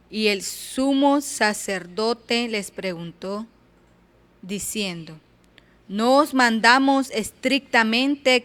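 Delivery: loud, emphatic Bible reading; preacher reading Acts aloud, loud and forceful; scripture reading. Acted.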